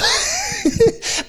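a sudden high burst of laughter. Real, not acted. Preacher laughing at his own disbelief, high laugh. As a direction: laugh it off, caught out by the memory.

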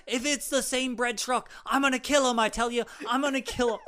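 high, loud acted ranting; hammed-up outraged shouting; mock furious threat. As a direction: yell it like an enraged son vowing revenge. Acting the son's furious threat.

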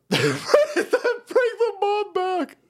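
high, slow laughing plea; drawn out through a laugh; laughing request. Real, not acted. Host laughing to chat, drawn out and high.

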